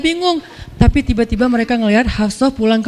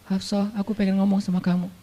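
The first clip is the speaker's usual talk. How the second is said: tender and soft; 3 semitones lower, 8 dB quieter than usual. Acted. The Prophet speaks gently to Hafsah.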